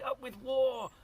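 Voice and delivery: high, loud war chant; rallying shout. A narrator's reading. T-rexes chant for war, high loud shout. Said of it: "And they shouted".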